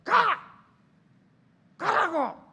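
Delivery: high, loud, separate bursts; angry girlfriend. Acted. Girlfriend after a big fight yelling at boyfriend, angry shouting. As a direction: yell him away in a fit of anger. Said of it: "여자가 막 화가 많이 났어요".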